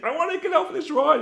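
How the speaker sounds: high-pitched panicky plea; acted panic. Rider about to be dropped, acted panic. Terrified rider's thought at the drop.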